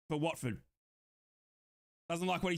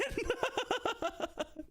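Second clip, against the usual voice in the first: high, long cackling laugh; giddy laugh; 13 semitones higher than usual. Real, not acted. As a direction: burst into high, delighted laughter. Giddy over favourite player.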